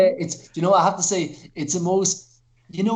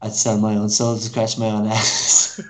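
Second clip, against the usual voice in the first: slow deadpan worm; 8 semitones lower than usual. Retold or imitated. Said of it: "And he says".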